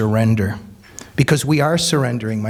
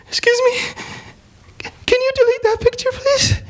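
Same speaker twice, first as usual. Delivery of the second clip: put-on much higher, small pleading girl voice; timid girl's voice. The hijabi girl begs the speaker to delete the photo, high and timid. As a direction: ask politely, small and high. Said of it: "The hijabi girl that was just".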